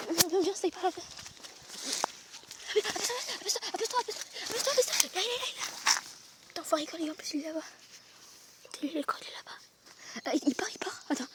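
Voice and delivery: whispering while hiding. Real, not acted. Hiding, urgent hushed talk.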